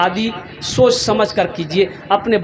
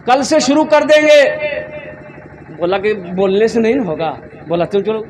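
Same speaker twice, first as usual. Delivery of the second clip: raised, chant-like sermon storytelling; rousing preacher's comic build-up; animated preaching; 5 semitones higher than usual. Acted. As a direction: rattle off the list like a refrain.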